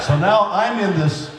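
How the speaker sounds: ordinary delivery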